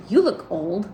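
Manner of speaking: loud, blunt verdict. Acted. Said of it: "Just that, like, bluntness".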